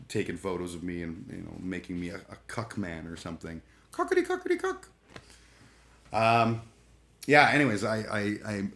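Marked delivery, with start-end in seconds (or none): 3.9-4.9 squeaky high-pitched chicken clucks; mocking high cluck noise. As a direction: cluck like a silly hen.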